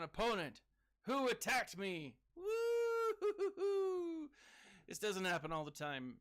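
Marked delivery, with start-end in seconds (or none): 2.4-4.3 high, loud, drawn-out cheering whoop; slow, high victory hoot; celebratory whoop. Real, not acted. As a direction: whoop it up like the crowd just roared. Celebrating opponent attacked him.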